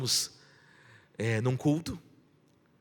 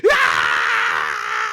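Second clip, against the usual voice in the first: retold scream; 11 semitones lower, 8 dB louder than usual. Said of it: "De repente ela grita".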